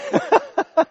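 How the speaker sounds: high pitched chuckle; amused high laugh. Real, not acted. Laughs after the punchline.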